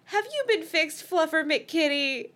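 high cooing to a cat; baby talk to the kitty, a bit slow. A narrator's reading. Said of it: "I cooed".

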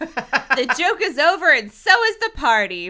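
loud, emphatic declaration; loud announcement. A narrator's reading. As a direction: announce it to the whole room. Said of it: "Brenda announced".

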